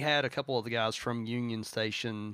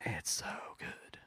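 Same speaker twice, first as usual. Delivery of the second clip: whispering; 4 semitones lower, 11 dB quieter than usual. Real, not acted. Awed praise of the song.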